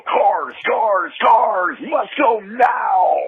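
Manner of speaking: slow, high robotic voice changer. Retold or imitated. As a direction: drag it out like a robot ad. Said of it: "Remember those old eighties commercials?".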